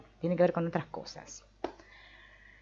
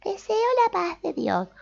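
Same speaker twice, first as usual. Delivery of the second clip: "Deseo la paz de Dios" sung out high; high-pitched; 4 dB louder than usual. Shown as a demonstration. Teacher showing the phrase said in a high tone. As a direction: chirp it up high and sweet. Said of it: "con diferentes tonos agudos y graves".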